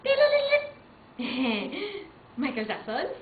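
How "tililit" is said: high, loud chirpy ring; ringing bell sound. Pretend. Buzzer before answering.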